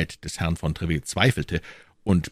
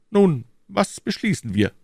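higher royal voice, firm; the king's voice, raised pitch; 13 semitones higher than usual. A narrator's reading. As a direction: speak as the king, assured. King voiced.

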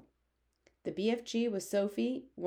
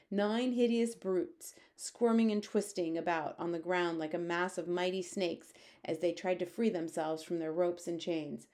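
usual speaking voice

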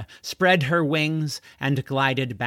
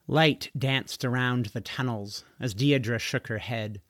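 ordinary delivery

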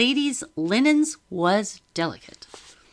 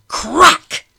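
loud high slap sound; sharp crack; 11 semitones higher, 15 dB louder than usual. A narrator's reading. Narrator voicing the slap: loud sharp crack.

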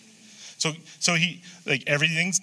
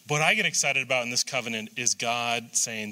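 normal talking voice

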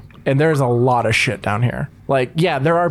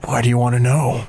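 gruff huffing badger; 3 semitones lower than usual. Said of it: "talking in weird badger voice".

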